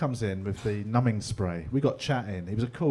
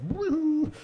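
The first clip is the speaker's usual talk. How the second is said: very high mock whoop. Comic describes the prep drink hitting, whooping. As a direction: let out a giddy little woo. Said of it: "the second drink just goes".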